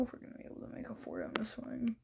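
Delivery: fast doubtful whisper. Real, not acted. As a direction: mutter it under your breath, quickly. Doubting the fort spot.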